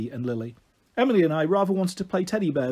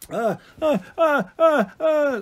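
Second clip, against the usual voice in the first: high, repeated wailing cries; small child's wail; 9 semitones higher, 4 dB louder, slower than usual. A narrator's reading. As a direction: wail like an indignant little one. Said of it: "Lily wailed".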